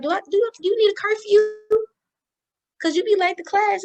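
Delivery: mother quoted asking about a curfew, high and loud; imitating mom.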